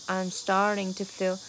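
"starting to" run together as one word, "to" clipped very short; short to, fused with starting. Shown as a demonstration. Teacher demos clipped "to" after "starting". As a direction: glue "to" onto "starting". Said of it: "这个to非常短".

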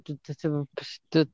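normal talking voice

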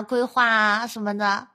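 normal talking voice

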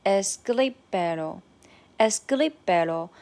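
escríbelo with the stress on gri, es-CRÍ-be-lo. Shown as a demonstration. Teacher modelling escríbelo for students, stress on gri. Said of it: "notice the intonation is ... the stress is in gri".

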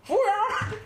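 high, piercing call for the waiter; Northeastern call for the waiter. Retold or imitated. Woman in a late-night congee shop calls the waiter, Northeastern shout. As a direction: yell for the waiter, Dongbei style. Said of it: "东北人喊服务员儿，她那个口气是不一样的".

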